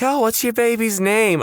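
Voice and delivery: high, chirpy receptionist question; mock clerk voice. Clerk cheerfully asks a new mother. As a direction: play a cheerful clerk asking. Mock official asking baby's name.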